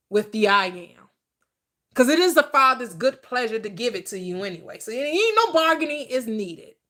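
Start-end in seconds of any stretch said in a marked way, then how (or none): none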